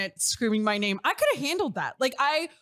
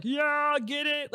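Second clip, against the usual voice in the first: imitating crazy crowd; 5 semitones higher, much slower than usual. Wedding guests egging on the newlyweds, imitated crazy cheering. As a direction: lightly imitate rowdy well-wishers cheering. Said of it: "they're just being crazy".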